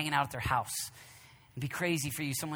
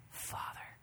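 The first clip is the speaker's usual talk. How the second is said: soft, breathy-free hush on one word; hushed word of prayer; whispered father; 12 dB quieter than usual. Acted. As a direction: whisper it reverently.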